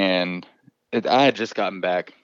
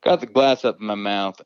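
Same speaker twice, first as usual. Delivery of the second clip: ordinary delivery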